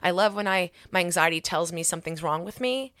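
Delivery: usual speaking voice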